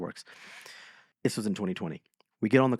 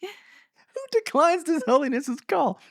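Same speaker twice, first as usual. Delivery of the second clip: high, slow disbelief; can't believe anyone would dare; stretched-out high astonishment; incredulous; 5 dB louder, slower than usual. Real, not acted. Incredulous rhetorical question.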